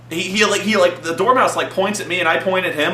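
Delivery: ordinary talk, nothing marked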